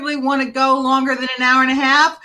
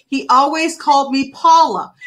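ordinary delivery

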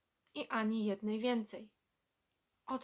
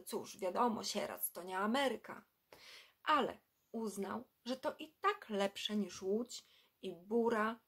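ordinary delivery, nothing marked

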